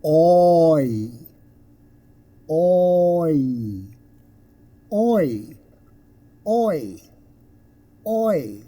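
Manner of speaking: "Oi" held, sliding from aw to ee; isolated, drawn-out "Oi" with falling tune; gliding vowel. Shown as a demonstration. Teacher models a diphthong for learners, "Oi" elongated. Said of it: "They're called gliding vowels".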